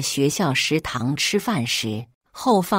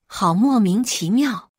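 plain reading voice

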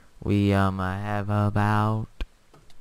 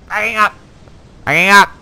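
loud, high call; calling out loudly; 13 semitones higher, 16 dB louder than usual. Real, not acted. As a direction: yell the move out. Calling out while reversing.

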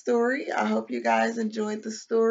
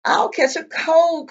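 higher, louder mock complaint; storybook complaint; 8 semitones higher, 9 dB louder, slower than usual. A narrator's reading. Mouse who slipped into the stream, complaining loudly. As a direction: complain like a put-upon little mouse. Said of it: "complained Mouse".

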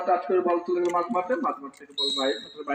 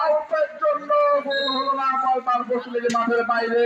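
excited commentary; 5 semitones higher than usual. Real, not acted. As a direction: groan at the missed chance on air. Near miss in live commentary. (উত্তেজিত ধারাভাষ্য)

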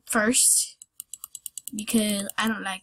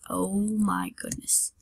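ordinary delivery

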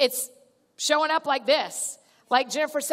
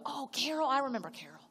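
soft recognition; 8 dB quieter, faster than usual. Retold or imitated. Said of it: "And you go".

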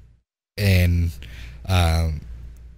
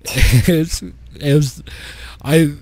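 laughing; 5 semitones higher, much slower than usual. Real, not acted. Laughing while recounting.

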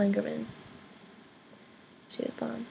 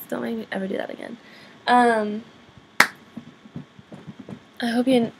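ordinary talk, nothing marked

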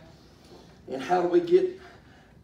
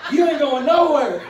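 loud, higher-pitched comic comeback; mock-indignant raised voice; defiant retort; 5 semitones higher, 9 dB louder than usual. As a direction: act out a spouse's emphatic refusal. Acted marital quarrel, defiant retort.

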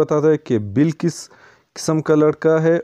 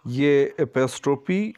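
normal talking voice

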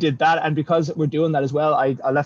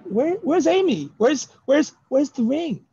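much higher mock-eager squeal of questions; imitated excited friends; 11 semitones higher, slower than usual. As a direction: mimic friends fussing over the fiancée. Imitates friends excited about fiancée.

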